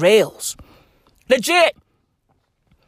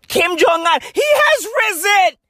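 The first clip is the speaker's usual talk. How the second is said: mock preacher; 10 semitones higher than usual. Preacher proclamation parody.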